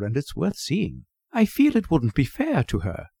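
high, lilting, anxious-to-please chatter; coaxing mother's voice; fussing, apologetic mother. Acted. Voices Missus Raddick asking a favour.

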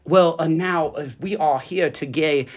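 slow put-on Southern accent; Duford. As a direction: imitate a teacher's drawn-out Southern speech. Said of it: "He does a Southern accent".

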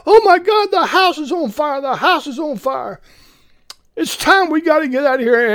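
sudden panic at the flames; panicked alarm. Retold or imitated. Family inside realizing their house burns, panicked cry.

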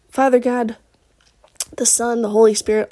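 usual speaking voice

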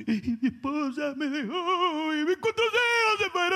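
whiny high imitation of a pastor; mock whining pastor. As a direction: whimper it like a self-pitying pastor. (imitación quejumbrosa y aguda de un pastor)

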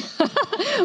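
a high burst of laughter. Real, not acted. Laughing at husband's teasing, high laugh.

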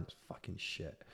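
whispered, fed-up curse; a whispered grumble about missing the show; bitter whisper. Real, not acted. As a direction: mutter it under the breath in disappointment. Missed Deal or No Deal.

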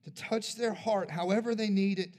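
a pastor's altar-call prayer, swelling; fervent prayer. Acted. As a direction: pray over them with building conviction. Closing prayer over the people.

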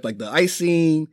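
ordinary talk

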